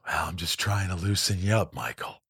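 low, soft, hushed impression of Clint Eastwood. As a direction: drop way down and murmur it like a calm old director. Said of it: "and he goes".

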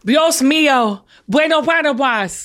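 slow, fumbling learner's Spanish; attempted Spanish accent. Shown as a demonstration. Southerner attempting her friend's phrase, clumsy Spanish accent. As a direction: imitate a Spanish accent and muddle it. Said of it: "focus on nailing this accent".

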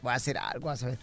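put-on very low, quiet drunken mumble; drunk husband. Drunk husband outside the door, low quiet mumble. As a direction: drop right down as a scheming drunk. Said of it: "el borracho dice".

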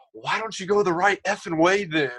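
high, sharp mock shout from the background; mock yell. Quarterback shouting at his fullback, hammed-up high yell. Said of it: "all you hear in the background is".

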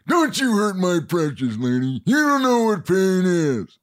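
put-on creepy character voice, rising and slowing at the end; menacing, drawn-out last line; obscure movie impressions. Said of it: "I do a very, you know, the obscure ones".

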